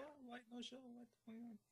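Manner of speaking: high, soft mock Brazilian accent; imitated Brazilian accent. Host mimics absent Brazilian caller asking about the show, imitated accent. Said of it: "your accent was too good".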